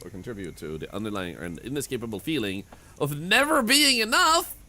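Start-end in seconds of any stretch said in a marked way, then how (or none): 3.0-4.6 slow, high and loud punchline; dramatic high finish. Acted. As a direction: land it with mock gravity.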